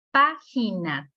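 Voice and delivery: Página split as PÁ-gi-na; syllable by syllable. Shown as a demonstration. Teacher counting syllables for students, Página syllable by syllable. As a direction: say Página in three syllables, first one stressed. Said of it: "tres sílabas".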